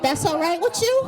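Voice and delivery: very high, baffled protest; exaggerated disbelief. Acted disbelief at mother on bus.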